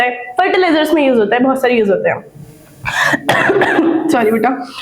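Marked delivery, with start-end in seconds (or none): 2.8-4.0 short throat-clearing cough fit; real cough. Real, not acted. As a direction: cough briefly mid-lecture. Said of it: "थोड़ी सी खांसी हो रही है".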